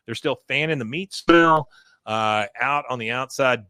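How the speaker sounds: normal talking voice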